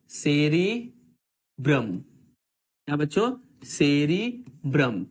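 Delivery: slow drill. Shown as a demonstration. Teacher drills the term.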